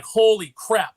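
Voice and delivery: stunned exclamation. Retold or imitated. Comic fan reacting to an impactful last page, loud amazed outburst. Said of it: "you're like".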